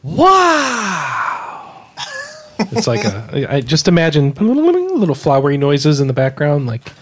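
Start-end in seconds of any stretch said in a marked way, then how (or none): none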